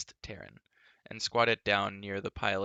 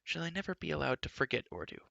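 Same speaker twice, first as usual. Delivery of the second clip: higher, lively bard voice; chatty wandering bard; 6 semitones higher, faster than usual. Acted. Bard's voice continues.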